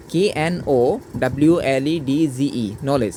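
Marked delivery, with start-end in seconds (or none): 2.8-3.1 "নলেজ" as no-lej, no D before the j; "নলেজ", DG sounded as a single j; silent D. Shown as a demonstration. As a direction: say "নলেজ" with the D left out. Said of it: "তাহলে ডির উচ্চারণ হয় না".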